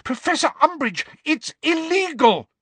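put-on very high, alarmed girl's voice; high, urgent shriek; shrieked protest. A narrator's reading. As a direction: protest in a panicky high cry. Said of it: "shrieked Hermione".

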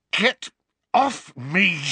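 high, strained, loud gasp; gasped protest. A narrator's reading. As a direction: gasp it out while being strangled. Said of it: "Harry gasped".

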